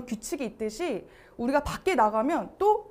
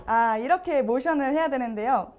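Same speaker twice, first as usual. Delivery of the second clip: ordinary talk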